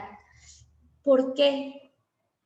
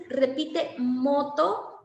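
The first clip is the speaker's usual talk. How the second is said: slow model phrase; much slower than usual. Shown as a demonstration. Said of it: "cuando ya le dices".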